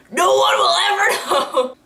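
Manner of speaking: gleeful laughing outburst; laughing shout. Real, not acted. Laughing over a silly joke.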